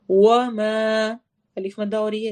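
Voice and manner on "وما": وما drawn out very slowly; long alif. Shown as a demonstration. Teacher reciting for the class, stretched. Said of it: "اس لیے میں نے تھوڑا لمبا کیا ہے".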